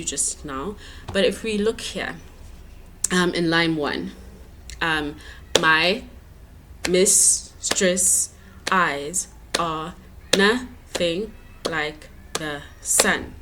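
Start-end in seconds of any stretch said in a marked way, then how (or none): 5.6-13.3 "My mistress' eyes are nothing like the sun" very slowly, one beat each syllable. Shown as a demonstration. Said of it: "let's count them".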